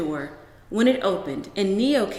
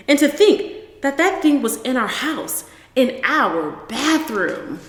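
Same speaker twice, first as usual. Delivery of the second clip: horrified exaggeration; 3 semitones higher, 5 dB louder than usual. Mother dramatizes frog horror.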